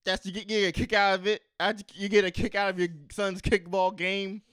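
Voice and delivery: loud, high incredulous question; disbelieving loud retort; incredulous bartender. Acted. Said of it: "she's like".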